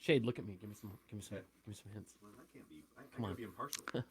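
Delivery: very low, hushed plea; furtive begging. Pretend. Contestant begs Shade for hints, furtive hushed pleading. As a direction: murmur it under your breath.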